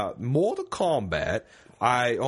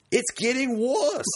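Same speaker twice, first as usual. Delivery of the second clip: slow, higher, hammed-up British accent; bad jolly British accent; 11 semitones higher, slower than usual. Host reading listener's letter, bad jolly British accent. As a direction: put on a badly faked jolly British voice, laughter creeping in. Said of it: "he asked for the jolly British accent".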